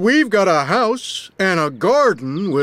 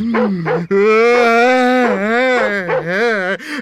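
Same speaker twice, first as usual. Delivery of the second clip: snickering laugh; 3 semitones higher than usual. Acted. Laughs at dog's big mouth.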